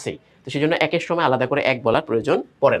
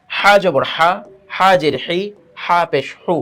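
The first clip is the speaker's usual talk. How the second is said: loud recitation; 2 semitones higher, 4 dB louder, slower than usual. Shown as a demonstration. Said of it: "তাড়াহুড়া".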